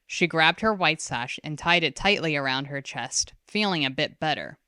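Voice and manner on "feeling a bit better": ordinary delivery, nothing marked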